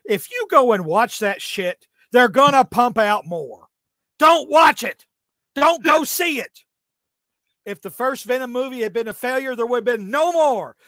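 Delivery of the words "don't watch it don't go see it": louder, higher, slow scolding; slow, loud scolding of the fans; stern warning. Real, not acted. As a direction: tell them off, each word separate. Scolding audience about Venom.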